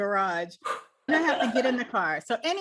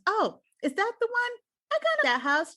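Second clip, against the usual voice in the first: mock bright; 12 semitones higher than usual. Mom playing along.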